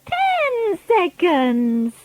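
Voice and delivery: slow witch countdown; Grand High Witch. A narrator's reading. Grand High Witch counting down before Bruno changes, slow. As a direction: drag out each word menacingly.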